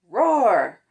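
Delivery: usual speaking voice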